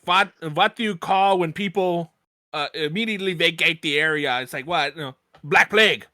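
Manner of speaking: put-on "vat" German-accented question; mock German with hard v. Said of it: "very German".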